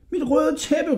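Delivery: high, urgent call; shouting. A narrator's reading. Hodja demanding his carpet from the innkeeper, high cry. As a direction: cry it out in distress. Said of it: "råbte Hodja".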